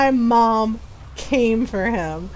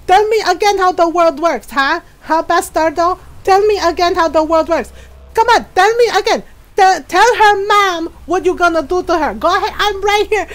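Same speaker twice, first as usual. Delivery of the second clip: loud, higher furious Filipino mom taunting; mock-furious, daring him to answer; yelling at the uncle who insulted her daughter; angry mom yelling; 5 semitones higher, 4 dB louder than usual.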